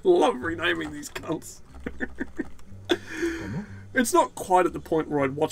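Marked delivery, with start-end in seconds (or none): 1.8-3.9 high unvoiced wheeze of laughter; breathy high laugh. Real, not acted. Player giggling at his renamed citizens, wheezing laugh.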